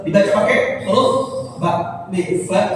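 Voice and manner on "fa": normal talking voice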